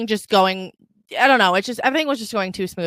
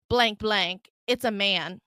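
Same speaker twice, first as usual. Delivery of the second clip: ordinary talk, nothing marked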